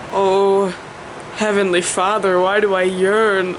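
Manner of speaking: slow, drawn-out theatrical lament; mock-anguished prayer. Vlogger asks heaven why she yearns, mock-anguished prayer. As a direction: plead to heaven in mock despair.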